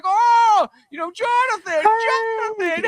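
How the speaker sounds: wife happily waving at her husband, high slow sing-song call; oblivious cheery wife. Retold or imitated. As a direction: call him sweetly, way up high, stretching out the name. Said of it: "She's like".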